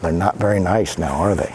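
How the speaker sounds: low, quick confiding aside. Real, not acted. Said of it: "aside to children".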